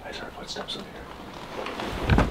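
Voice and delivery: slow, hushed whisper. Real, not acted. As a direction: breathe it out slowly, scared to be heard. Hears footsteps, hushing the group.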